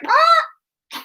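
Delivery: very high, short dog cry; high strangled mock yelp; imitated dog yelp. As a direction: yelp like a dog pulled up on its leash. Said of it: "So the dog's like".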